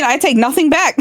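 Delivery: ordinary delivery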